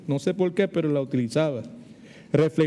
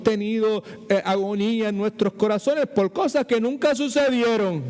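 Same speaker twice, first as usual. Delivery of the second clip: dramatic lament; 4 semitones higher than usual. Acted. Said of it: "con ese tono así".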